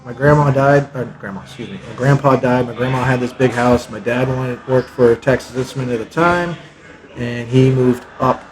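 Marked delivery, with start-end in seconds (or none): none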